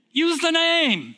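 high, loud and slow, hammering out "Use the name."; preaching shout. Real, not acted. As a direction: cry it out to the congregation at full force. Preaching climax, exhorting the congregation.

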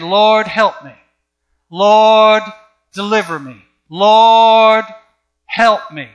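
loud, drawn-out cries of "Lord"; mock pleading. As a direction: imitate desperate begging in prayer, hammed-up. Mimicking believers begging God for help.

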